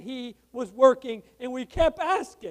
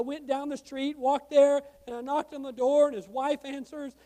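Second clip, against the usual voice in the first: usual speaking voice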